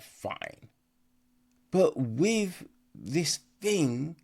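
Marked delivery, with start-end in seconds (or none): 0.0-0.7 a tired, whispered fine; resigned whisper. Retold or imitated. Reviewer accepting a plot twist, in a resigned whisper. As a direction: let it go under your breath. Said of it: "You're like".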